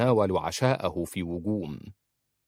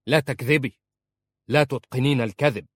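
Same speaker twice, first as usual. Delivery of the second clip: sharp accusing; 4 semitones higher, 5 dB louder than usual. Acted. Husband cuts her off.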